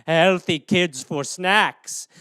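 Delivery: hammed-up menace; sinister gloating. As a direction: savour each word like a child-eating ghoul. Cannibal soccer mom bit.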